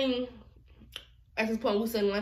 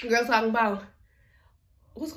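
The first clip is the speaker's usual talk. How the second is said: ordinary talk, nothing marked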